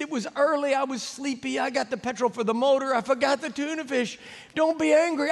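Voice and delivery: high, timid pleading; apologetic guide pleading with the boss; pleading Carlos. Retold or imitated. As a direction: beg him not to be angry. Carlos excuses himself.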